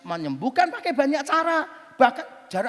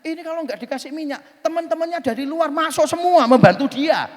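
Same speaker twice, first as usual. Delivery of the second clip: raised pitch, building to loud; mock earnest explanation; 4 semitones higher, 7 dB louder than usual. Friend explaining why he oils windows, mock earnest. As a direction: imitate an insistent believer, swelling at the end.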